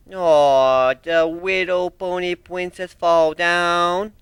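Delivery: slow mocking baby talk; slow mock pity; chiding. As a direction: tease her like a toddler. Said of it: "I chided".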